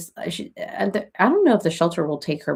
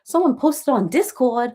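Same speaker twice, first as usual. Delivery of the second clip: put-on Boston accent; a playful go at a Boston accent; 5 semitones higher, slower than usual. Shown as a demonstration. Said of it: "That was my Boston accent".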